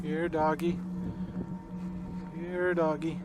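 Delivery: calling out. Real, not acted. Hunter calling to an unseen dog, loud call. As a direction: call out into the dark trees.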